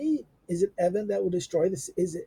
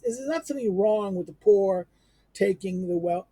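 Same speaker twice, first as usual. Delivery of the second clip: usual speaking voice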